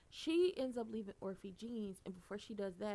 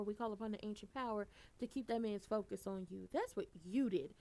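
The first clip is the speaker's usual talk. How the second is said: ordinary delivery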